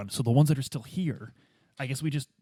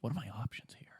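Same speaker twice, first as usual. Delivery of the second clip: whispered aside; 3 semitones lower, 15 dB quieter than usual. Acted. Detective whispering to the game master for options. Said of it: "unvoiced whisper".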